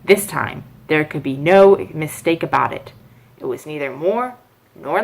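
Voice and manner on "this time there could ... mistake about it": ordinary delivery, nothing marked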